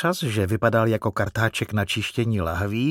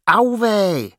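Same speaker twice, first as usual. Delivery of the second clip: put-on high, loud little-boy yelp; raised, high-pitched squeal of protest; Teddy's cry; 9 semitones higher, 5 dB louder than usual. A narrator's reading. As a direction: cry out like a tickled child. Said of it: "vykřikl Teddy".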